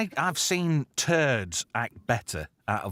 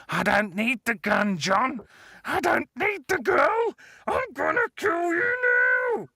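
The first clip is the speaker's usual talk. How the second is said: much higher, shouting, unhinged; villain's crazed shout; 5 dB louder than usual. Retold or imitated. Bennett losing it at John, crazed villain shout. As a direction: scream the threat out of control. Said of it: "Bennett's climax line".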